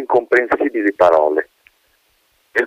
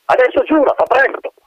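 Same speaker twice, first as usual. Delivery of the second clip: impatient colonel. Acted. Colonel orders the wind.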